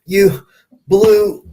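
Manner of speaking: sing-song farewell with a playful jab; a hint of a sassy waitress; teasing waitress. Waitress voiced in retold story.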